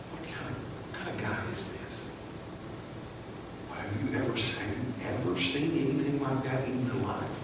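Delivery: very soft, awed murmur; hushed talk among themselves. Acted. Disciples talking amongst themselves after the storm calms, hushed. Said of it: "begin to talk amongst themselves".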